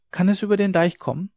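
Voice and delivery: lightly voiced child, high anxious question; child's voice. A narrator's reading. Child fears water crossing the dike, lightly voiced child. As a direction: ask as a worried small child.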